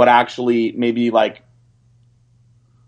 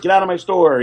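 laughing dismissal; 7 semitones higher than usual. Retold or imitated. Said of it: "he'll be like".

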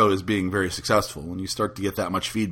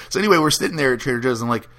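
ordinary talk, nothing marked

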